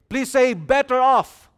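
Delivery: rally the church to repeat; calling out. Acted. Preacher calls congregation to repeat.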